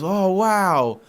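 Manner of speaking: high, drawn-out wonder; mock-impressed older customer; imitated amazement. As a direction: marvel like an awestruck first-timer. Imitating customer marvelling at place.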